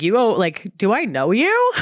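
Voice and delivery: confused stranger. Retold or imitated.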